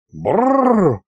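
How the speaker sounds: high rolled shudder; shuddering brrr. A narrator's reading. Aristofan disgusted by the ghost's list, shuddering brrr. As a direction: shudder aloud with rolled lips. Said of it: "содрогнулся Аристофан".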